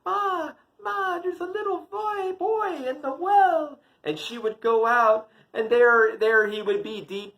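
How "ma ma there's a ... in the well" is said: voice from the well. Acted. Child calls to mother from the well, high wailing voice. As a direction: call up thinly for Ma, acting a little boy. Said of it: "there was a voice saying".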